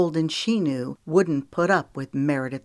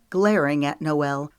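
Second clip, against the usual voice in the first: usual speaking voice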